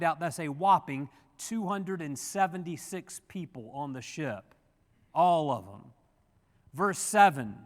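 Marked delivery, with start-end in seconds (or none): 5.1-5.9 All said louder than the lines around it; stress on all. Shown as a demonstration. The preacher stresses All to the congregation. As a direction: lean hard on All. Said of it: "Emphasis on the word all".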